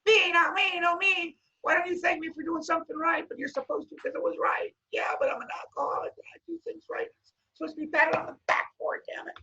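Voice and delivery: high squeaky whining inner voice; the alcoholic brain arguing with itself, high and whiny. Retold or imitated. Voicing the demons between his ears, high and whiny.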